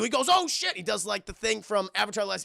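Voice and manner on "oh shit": high startled yelp; mock alarm. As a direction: blurt it high, as if caught out. Imitating a startled Dooku.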